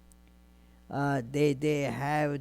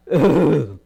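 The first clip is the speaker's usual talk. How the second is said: loud, throaty mock burp; imitated belch; 14 dB louder than usual. Sages too full to eat, loud mock belching. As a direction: fake a big belch from the throat. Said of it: "They started belching".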